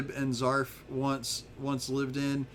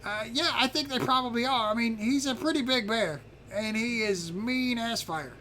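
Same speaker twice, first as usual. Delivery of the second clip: high character voice, slowing at the end; little dragon informant; 8 semitones higher than usual. Acted. Little dragon warning visitors about the big bear, high character voice. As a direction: play a small dragon sizing up a bear.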